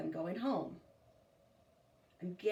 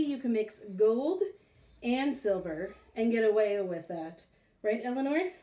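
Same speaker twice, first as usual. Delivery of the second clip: usual speaking voice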